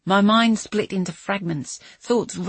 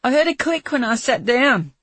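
high, loud call from inside a car; frightened call; 6 semitones higher, 6 dB louder than usual. Acted. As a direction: call out through glass. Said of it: "Zach called, his voice muffled by the glass".

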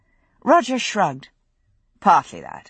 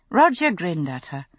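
usual speaking voice

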